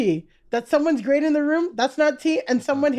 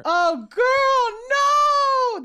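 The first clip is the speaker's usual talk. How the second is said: high, long-drawn cry of dismay; dismayed disbelief; 11 semitones higher, much slower than usual. Real, not acted. Reacting to gossip about a cheating husband, real dismay. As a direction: stretch it out high in scandalised sympathy.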